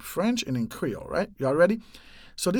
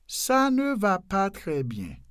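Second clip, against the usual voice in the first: "Ça ne va pas très bien" slow and connected, pitched up; slowed-down model; 4 semitones higher than usual. Shown as a demonstration. Teacher repeating the French sentence more slowly.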